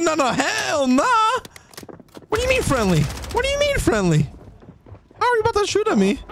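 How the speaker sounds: high, incredulous protest; indignant outrage. Real, not acted. Player arguing with an enemy who says friendly, high indignant outrage. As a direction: call out the fake friendly.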